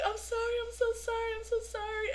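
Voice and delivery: high, whimpering apology; mock crying apology. Crying fan apologizes to the star, high mock whimper. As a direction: imitate a tearful fan apologizing. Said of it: "crying, and I'm like".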